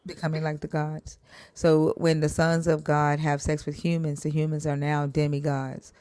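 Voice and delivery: ordinary talk, nothing marked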